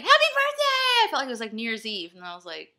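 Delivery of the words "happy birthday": high, loud birthday squeal; excited cheer. Retold or imitated. Celebrating her birthday at midnight, squealing loud and high. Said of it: "I'm like".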